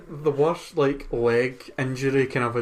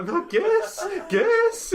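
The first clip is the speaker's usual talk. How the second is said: squealing mock insistence; much slower than usual. Mimicking a flatmate begging her to guess, squealing higher. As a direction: insist like an overexcited child. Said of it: "you come in giggling".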